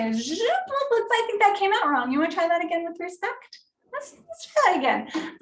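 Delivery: playful redirect. Shown as a demonstration. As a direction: nudge them to try again respectfully. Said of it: "some friends that are a little bit sassy, so I might say".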